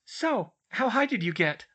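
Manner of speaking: high, smug little question. A narrator's reading. Hermione asks the pranked twins, smug. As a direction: ask it high, with a smug smile. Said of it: "smiled smugly and said".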